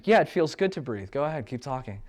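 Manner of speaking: ordinary delivery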